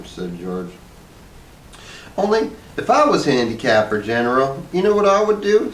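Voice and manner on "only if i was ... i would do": high, eager wife voice, rising; Hazel's voice. A narrator's reading. Wife floating her big idea, high and eager. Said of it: "said Hazel".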